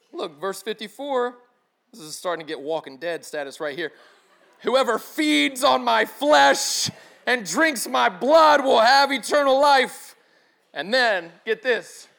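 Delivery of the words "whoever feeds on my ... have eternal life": loud, slow, weighty proclamation; booming scripture reading. Acted. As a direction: thunder the verse out slowly. Dramatic scripture reading in a sermon.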